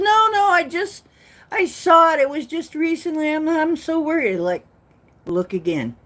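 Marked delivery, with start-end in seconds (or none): 0.0-4.4 high, loud, anxious friend voice; worried friend. Retold or imitated. Friend insisting on the phone she saw the warning, high and anxious. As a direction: pitch up into her fretful worry.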